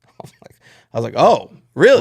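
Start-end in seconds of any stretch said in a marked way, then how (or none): 1.2-2.0 mock surprise. Hearing racist gym remark.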